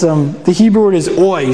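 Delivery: normal talking voice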